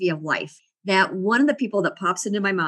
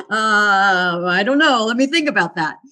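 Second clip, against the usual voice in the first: put-on a nervous kid answering the teacher; flustered; 6 dB louder than usual. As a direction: stall like a panicked student put on the spot. Flustered student answer, retold.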